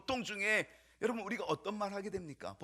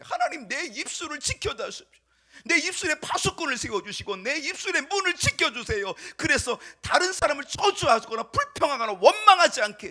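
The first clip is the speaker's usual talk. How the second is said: high, earnest plea swelling louder; pleading prayer; 10 semitones higher, 14 dB louder than usual. Acted. David's constant prayer to God against cursing others, pleading. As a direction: implore God, building in force. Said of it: "바로 이 기도가 있었던".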